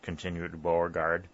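ordinary delivery, nothing marked

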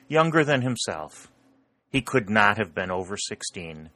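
usual speaking voice

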